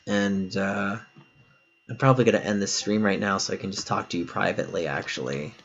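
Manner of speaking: low, soft, dropping away; quiet aside. Real, not acted. Streamer to guest about talking privately, quiet aside.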